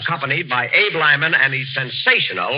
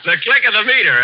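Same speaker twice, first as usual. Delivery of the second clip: higher and louder mock repeat; mocking echo; 6 semitones higher, 4 dB louder than usual. Real, not acted. Repeating the bandleader's line back, mockingly. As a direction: savour the silly phrase, teasing.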